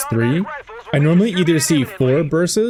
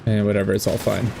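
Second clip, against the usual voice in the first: put-on a light Australian accent, lower and softer; 5 semitones lower, 4 dB quieter, faster than usual. Caster shrugging off a detail in a hint of Australian accent. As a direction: slip into a bit of an Australian accent, offhand. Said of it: "I said that with an Australian accent".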